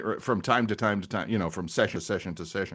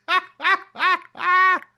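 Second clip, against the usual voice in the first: high laugh; 6 dB louder than usual. Real, not acted. Reacting to joke.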